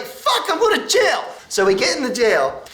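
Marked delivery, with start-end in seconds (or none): 0.0-1.2 very high, worked-up exclamation; mock reckless outburst. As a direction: blurt it out, half laughing. Acting out young defiant panic, laughing.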